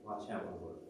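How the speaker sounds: soft, low, hushed command; God speaking quietly. Retold or imitated. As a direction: hush him gently, leaving long pauses. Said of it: "it was like God was saying".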